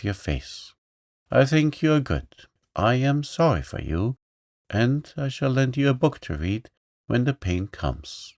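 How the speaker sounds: judge him like a little princess; little girl voice. Acted. Princess Fay speaks.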